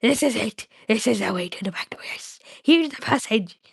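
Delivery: hissy creature finding the way; urgent discovery in a half-whispered Gollum voice; Gollum, excited. Acted. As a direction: creature muttering, rising at the end.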